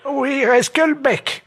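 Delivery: high, slow granny; calm old lady. Pretend.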